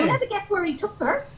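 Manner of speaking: high, breathless gossip voice; dotty wig character. Acted. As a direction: spill juicy gossip, giddy. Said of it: "this one here, it's a lovely person".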